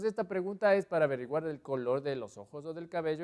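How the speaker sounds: ordinary talk, nothing marked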